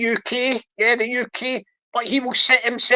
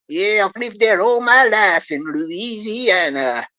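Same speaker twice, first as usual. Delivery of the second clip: put-on Louisiana accent; 2 semitones lower than usual. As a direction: draw out a hammed-up Louisiana accent. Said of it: "speak with his American Louisiana accent".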